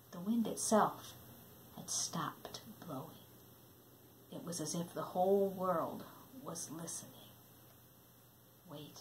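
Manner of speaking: breathy hush of suspense; whisper, the world is listening. A narrator's reading. Stillness, whispered.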